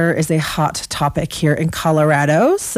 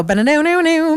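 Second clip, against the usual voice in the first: higher quick banjo riff by mouth; banjo impression; 9 semitones higher than usual. Shown as a demonstration. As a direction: hum the banjo lick again. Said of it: "my best impersonation of a banjo".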